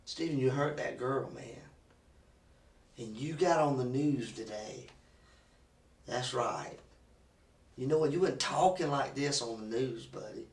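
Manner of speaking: detective quietly needling the suspect; quiet pressure. Real, not acted. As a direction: drop your voice, press him quietly. Interrogator drops to quiet pressure.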